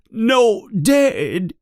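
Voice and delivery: high, drawn-out plaintive wail; distraught questioning. A narrator's reading. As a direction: plead for an answer in a high voice. Distraught owner questions the headmaster.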